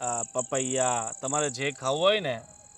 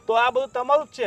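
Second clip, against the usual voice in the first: raised plea; 8 semitones higher, 7 dB louder than usual. Acted. Nephew pleads with angry uncle.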